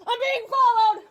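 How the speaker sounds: panicked tearful yell for help; crying scream. Real, not acted. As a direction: scream it out close to tears. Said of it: "You cannot be screaming like that".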